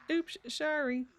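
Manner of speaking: high, squeaky play apology, slurring into "sh"; silly mock apology. Player echoing their own slip, silly mock apology. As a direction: repeat it in a silly high little voice.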